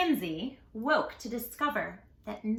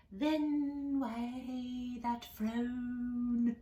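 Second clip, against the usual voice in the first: very slow, softer; slow snake voice; 5 dB quieter, much slower than usual. Acted. Snake questioning Jim's frown, very slow. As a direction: draw it out slyly.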